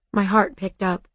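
usual speaking voice

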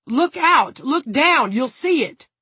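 high, loud bursts of shouting; urgent yelling. A narrator's reading. Frank pleading with the guard to look, urgent yell. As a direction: shout each command to make him look.

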